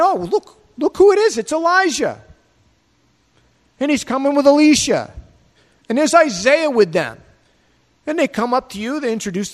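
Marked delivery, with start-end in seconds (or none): 0.0-7.2 much higher, thrilled and a little louder; excited recognition. Pretend. In heaven, greeting Elijah, Elisha and Isaiah, much higher and thrilled. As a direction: spot old friends across the room with delight.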